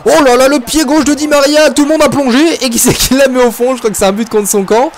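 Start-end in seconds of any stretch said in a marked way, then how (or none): 0.1-3.7 high-pitched, excited exclamation; thrilled live football reaction; excited commentary. Real, not acted. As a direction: marvel at the play as it happens. Reacting live to a goal chance.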